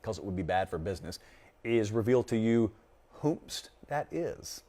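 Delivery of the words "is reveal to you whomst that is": slow, soft, mock-mysterious drawl on whomst; a playful hush to hold back the pick; teasing tease. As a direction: dangle the secret slowly and softly. Teasing withheld prediction.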